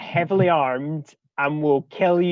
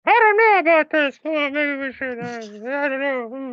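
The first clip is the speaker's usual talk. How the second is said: high silly voice; 10 semitones higher than usual. Acted. Character voice in scene.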